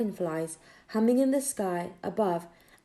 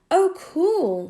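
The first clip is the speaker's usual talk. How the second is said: put-on high, louder delighted boy; higher, louder excited child; impressed boy; 8 semitones higher, 8 dB louder than usual. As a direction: sound amazed like a kid. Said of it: "said Tom".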